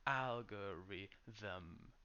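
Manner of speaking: algorithm drawn out slowly, stress on AL, full TH syllable; slow careful demo. Shown as a demonstration. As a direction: stretch out algorithm, giving the extra syllable time. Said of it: "so give it a little time".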